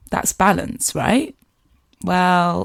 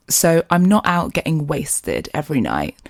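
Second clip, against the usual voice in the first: ordinary talk, nothing marked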